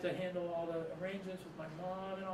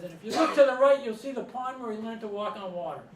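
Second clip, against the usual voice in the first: high, loud mock tour announcement; punchline called out, high and loud at first; teammate on microphone; 5 semitones higher, 8 dB louder than usual. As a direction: imitate a heckler taking over the bus tour. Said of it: "grabs the microphone and says".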